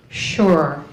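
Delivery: low, falling one-word impression; imitated deadpan assent. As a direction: drop it low like her nod. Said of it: "be like".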